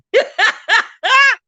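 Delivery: a loud high cackle that bursts out; big loud cackling laugh. Real, not acted. Live-stream host bursts into her famous loud laugh. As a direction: throw your head back and cackle.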